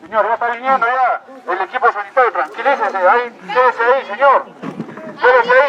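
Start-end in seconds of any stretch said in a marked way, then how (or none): 5.2-5.7 sharp shout. Acted. Officer to the collapsed man, sudden high shout. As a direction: stop him with a sudden cry.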